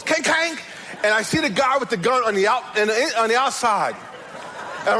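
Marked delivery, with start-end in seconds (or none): 0.0-0.6 high-pitched metallic clanging noise; sharp, high "kang, kang"; imitated gate clang. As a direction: mimic a gate slamming shut. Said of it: "I hear the gate close".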